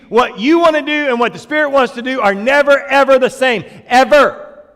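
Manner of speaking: loud, high, driving sermon voice; fiery preaching. Acted. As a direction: preach it hard, pounding the point home. Preaching at full force.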